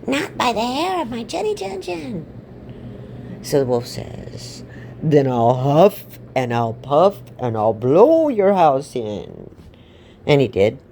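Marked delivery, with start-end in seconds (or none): 0.1-2.2 high, squeaky little pig voice; high-voiced storybook piglet refusing. Little pig answers the wolf. 5.0-9.5 big, slow, booming wolf threat; storybook big bad wolf, loud and drawn-out; threatening wolf.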